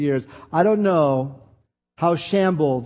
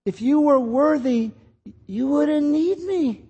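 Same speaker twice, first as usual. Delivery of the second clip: still small voice of God; 9 semitones higher than usual. Retold or imitated. God's still small voice urging Bill to stop stalling, higher and pleading. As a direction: lift the pitch and speak as God coaxing Bill. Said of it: "this still small voice started whispering".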